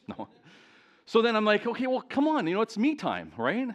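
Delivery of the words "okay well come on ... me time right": mock impatient, pitched up; a bit of hammed-up impatience, higher. Retold impatient self-talk.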